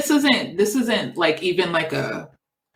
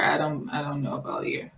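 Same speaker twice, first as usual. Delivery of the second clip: breathy whispered doubt; 4 semitones lower, 8 dB quieter than usual. Real, not acted. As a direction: whisper it to yourself. Doubtful aside to herself.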